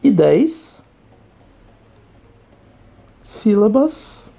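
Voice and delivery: slow, each word set apart with long pauses; deliberate teacher summary, slow and spaced; slow emphatic answer. Shown as a demonstration. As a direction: lay out the answer word by word, very slowly.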